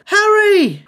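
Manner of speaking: high, loud scream of a name. A narrator's reading. Hermione screams a warning to Harry, high and loud. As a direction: shriek the name in alarm. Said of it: "Hermione screamed".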